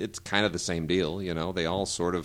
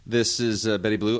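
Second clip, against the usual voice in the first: ordinary delivery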